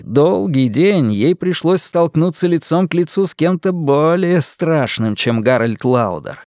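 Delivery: ordinary delivery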